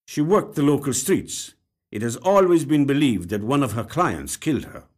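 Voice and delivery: lightly voiced detective reading a case file; detective reciting. A narrator's reading.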